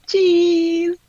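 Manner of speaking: loud, high, drawn-out cheese; stretched high "cheese"; saying cheese. Pretend. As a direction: sing out a long photo cheese. Posing for a photo.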